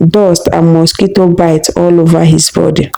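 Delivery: usual speaking voice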